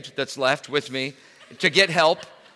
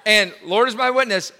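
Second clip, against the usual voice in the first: ordinary delivery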